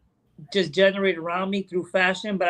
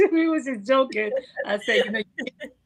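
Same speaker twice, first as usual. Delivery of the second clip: higher-pitched, laughing through the words; amused laughing; 7 semitones higher than usual. Real, not acted. Podcast guest admits to co-host it was just joking, laughing. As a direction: laugh while owning up to the joke.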